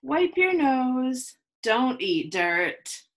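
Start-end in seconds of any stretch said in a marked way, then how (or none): none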